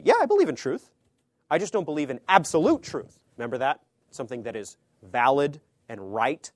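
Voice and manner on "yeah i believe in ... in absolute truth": much higher, louder mock-casual voice; mocking relativist. Preacher parodying people who reject absolute truth, high and mocking. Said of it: "a lot of people today will say".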